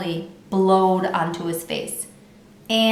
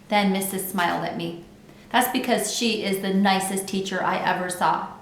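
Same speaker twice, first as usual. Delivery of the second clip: ordinary delivery, nothing marked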